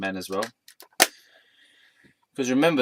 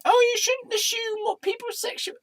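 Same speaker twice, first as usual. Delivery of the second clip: mock scolding objector. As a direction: mimic a squeaky, preachy critic.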